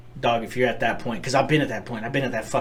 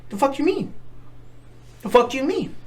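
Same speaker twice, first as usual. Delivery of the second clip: higher, pushing back in disbelief; a stunned, rising what the fuck; disbelieving shock; 13 semitones higher than usual. Retold or imitated. Hearing homeboy killed himself, much higher disbelieving shock. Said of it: "And you're like".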